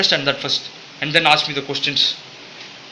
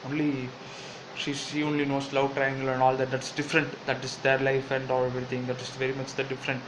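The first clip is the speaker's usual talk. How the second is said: quiet aside; 7 dB quieter than usual. Acted. Jill muttering an aside.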